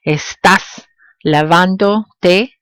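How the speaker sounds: Estás lavándote slowly, piece by piece, te separate; pronoun held apart. Shown as a demonstration. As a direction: gaps in Estás lavándote, each piece rising. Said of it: "when pronouns are added to a verb form".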